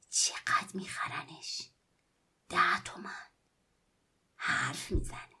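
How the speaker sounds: slow whispered musing; boy figures the doll's price, slow and whispered; muttering to himself. Acted. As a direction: whisper the plan under your breath. Boy muttering his plan to himself.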